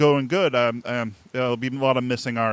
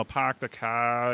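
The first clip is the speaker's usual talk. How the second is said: slow, soft Boston accent with the R dropped, "pahk the cah"; 8 dB quieter, much slower than usual. Shown as a demonstration. Host demos his Boston accent for the guest, slow and soft. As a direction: show off the Boston accent and drop every R. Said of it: "'cause I drop my R's".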